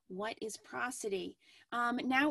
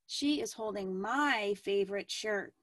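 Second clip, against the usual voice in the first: "my" stretched and punched louder; stress on my; 4 dB louder than usual. Shown as a demonstration. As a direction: lean hard on "my" to show whose it is. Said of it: "place the emphasis, emphasis on different words".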